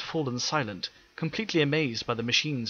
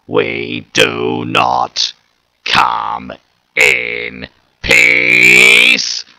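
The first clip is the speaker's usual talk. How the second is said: much louder, slow robotic Dalek shout; 16 dB louder, much slower than usual. Acted. Dalek declaring war on the mayor, loud robotic shout. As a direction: blare each word like a war machine. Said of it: "the Dalek shouted loudly".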